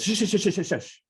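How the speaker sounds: high, quick shushing of the stage manager; hushing. Retold or imitated. Les cutting off the stage manager, high mock shushing. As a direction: wave the argument down with a hiss of shushes. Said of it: "And Les just goes".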